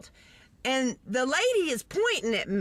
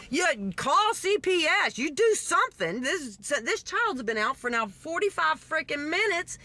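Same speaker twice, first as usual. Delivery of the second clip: higher, emphatic, fed-up insistence; exasperated demand; 5 semitones higher than usual. Retold or imitated. Bystander urges police to act, exasperated. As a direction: hammer each order in exasperation.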